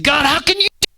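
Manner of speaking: anguished high cry to God; high-pitched, pleading outburst. Acted. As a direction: cry out to God under duress. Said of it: "he's crying out saying".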